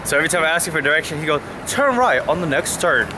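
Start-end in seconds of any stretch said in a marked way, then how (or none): 1.7-3.1 slow, higher imitation of a British sat-nav voice; British Siri voice. Friend imitates his British-accented Siri for his mate, mock British accent. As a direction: mimic the posh British Siri giving directions. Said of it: "That's how he sounds".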